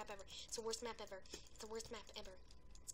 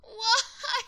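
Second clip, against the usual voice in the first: outraged shout; 9 semitones higher, 13 dB louder than usual. Real, not acted. Losing a round, shouting at opponent.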